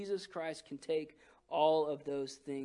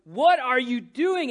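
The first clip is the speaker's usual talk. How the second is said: loud slow inner yell; panicked inner shout; 6 semitones higher, 10 dB louder, slower than usual. Retold or imitated. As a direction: yell at yourself in your head. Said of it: "I'm going".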